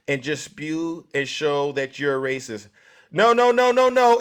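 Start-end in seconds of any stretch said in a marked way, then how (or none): 3.1-4.2 emphatic no. Real, not acted. Cutting off a caller's claim.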